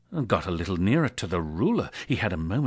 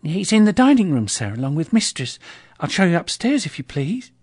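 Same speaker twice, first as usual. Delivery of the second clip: high, bright-voiced maid, polite; a young housemaid showing a guest upstairs; lightly higher maid's voice; servant girl; 10 semitones higher, 8 dB louder than usual. Servant girl answering Scrooge.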